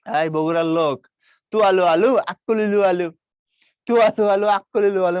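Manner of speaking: a loud, hammed-up Bogura accent; a playful sing-song Bogura accent; Bogura dialect. Shown as a demonstration. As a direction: shout it out like a Bogura villager. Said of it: "বগুড়াতে কি বললো".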